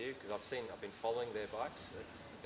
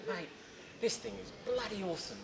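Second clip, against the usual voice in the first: mock-thrilled, pitched high and gushing; imitating a buddy raving about the bike; excited mate on the phone; 7 semitones higher than usual. Imitating the rider calling from the roadside, gushing high. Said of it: "pull over and say".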